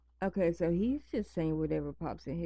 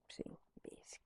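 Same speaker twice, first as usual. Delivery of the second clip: real hushed whisper; whispered so the pets stay asleep; 19 dB quieter than usual. Real, not acted. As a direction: breathe the name softly. Whispering near sleeping pets.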